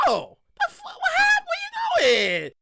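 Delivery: high cracking yell; high-pitched outraged shout; mock outraged yell. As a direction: squeal it out, offended. Outraged at cock blocker.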